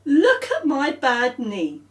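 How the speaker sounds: put-on slow whiny child; Claire's voice. Girl showing Paul her knee, slow and whiny. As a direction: show off the hurt knee.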